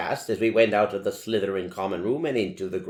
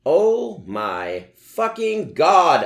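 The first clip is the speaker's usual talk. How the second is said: loud, higher, slow thrilled scream; 12 semitones higher, 11 dB louder, much slower than usual. Acted. Ebony hearing about the concert, slow loud scream. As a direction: scream it out in delight. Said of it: "I screamed".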